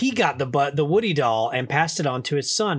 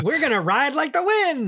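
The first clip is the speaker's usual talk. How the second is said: higher, hammed-up cry; mock heroic cowboy; 10 semitones higher than usual. Cohost answering the horse bit, hammed-up. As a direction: belt it like a cartoon hero. Reply in goofy voice.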